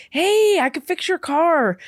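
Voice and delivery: mock-eager high call; imitated ingratiating offer, high-pitched; eager favor-offerer. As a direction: pipe up like an overkeen volunteer. Imitating favour-seekers at the club.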